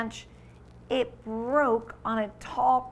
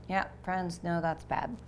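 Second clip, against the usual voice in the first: usual speaking voice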